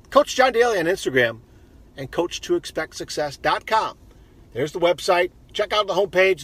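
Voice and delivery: practised, upbeat sign-off read; podcast outro plug. Acted. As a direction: rattle off where to find you, host-style. Reads out social media plugs.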